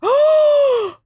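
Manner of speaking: a sharp high gasp of delight; loud gasp. Real, not acted. Streamer sees the hole return, loud gasp.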